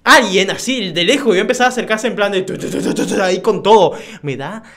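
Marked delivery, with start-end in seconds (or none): 2.6-3.2 imitated footsteps.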